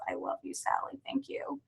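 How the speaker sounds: a touched whisper to a friend; whispered thanks. Real, not acted. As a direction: breathe it out as a private whisper. Touched by a viewer's comment.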